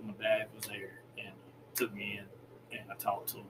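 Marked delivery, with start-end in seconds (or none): none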